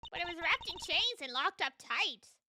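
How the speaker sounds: put-on "wrapped in chains and locked up tight" in a folksy Southern accent; silly southern accent. Witness describes the locked box in a silly Southern accent. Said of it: "the silly southern accent".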